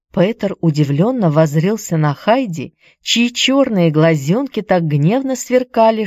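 plain reading voice

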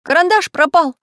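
high, loud worried cry; alarmed. Acted. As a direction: blurt out the alarm. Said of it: "волнуясь, сообщил".

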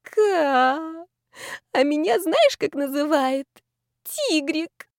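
high, cooing tipsy voice; drawn-out high-pitched swooning; dreamy drawl. A narrator's reading. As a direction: stretch it out, lovestruck. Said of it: "Я отмахнулась и протянула".

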